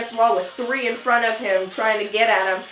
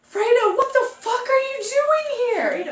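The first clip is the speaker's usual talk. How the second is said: very high mock-shocked squeal; shocked outburst; 14 semitones higher than usual. Dreamer finds her ex-boyfriend there, shocked high squeal. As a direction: squeal it in acted disbelief.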